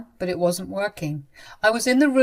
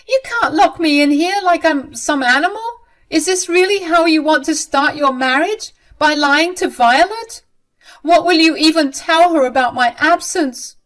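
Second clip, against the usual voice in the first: indignant protest; 7 semitones higher, 6 dB louder than usual. Acted. Mother protests being locked up.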